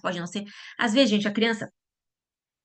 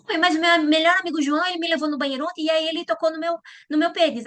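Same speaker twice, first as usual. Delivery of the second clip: put-on child telling; 7 semitones higher than usual. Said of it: "aí seu filho fala assim".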